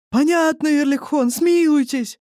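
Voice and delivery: frightened crowd begging for mercy; crowd wailing. A narrator's reading. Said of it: "заголосили люди". (громкий умоляющий вопль, намного выше)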